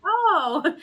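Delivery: little daughter's oh. Retold or imitated. Daughter reacting to gnome.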